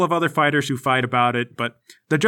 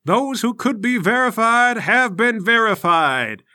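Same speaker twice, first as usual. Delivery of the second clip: proclaiming official; 5 semitones higher than usual. Acted. Official addressing the fighters, ceremonial proclamation. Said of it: "An official comes out and says".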